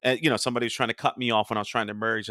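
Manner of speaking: normal talking voice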